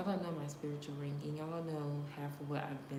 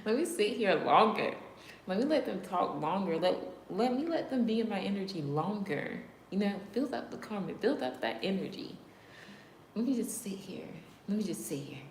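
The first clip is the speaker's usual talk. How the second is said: loud, higher, gloating; gleeful taunt; 4 semitones higher, 6 dB louder than usual. Real, not acted. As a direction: savor making them wait. Mock-gleeful taunting of trolls.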